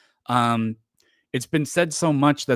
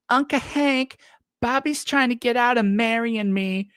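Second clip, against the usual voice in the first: put-on high, whiny girl calling from another room; Luann yelling; 12 semitones higher than usual. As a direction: call out to Uncle Hank in a high girly whine. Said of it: "Luann just yells out".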